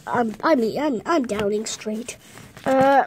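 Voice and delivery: ordinary talk, nothing marked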